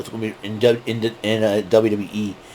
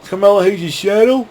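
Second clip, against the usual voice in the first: mock taunt, pitched higher; higher mocking voice for the rival; Dominic Mysterio taunting; 9 semitones higher than usual. Imitating a wrestler taunting Carmelo Hayes, higher mock taunt. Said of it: "Dominic Mysterio goes".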